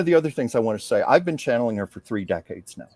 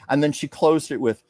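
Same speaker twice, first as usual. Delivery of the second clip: ordinary talk, nothing marked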